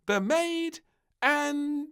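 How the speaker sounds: put-on high, slow announcing child voice; princess announcing. A narrator's reading. Princess awarding first prize, high and proud. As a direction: proclaim the winner grandly. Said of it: "she cried".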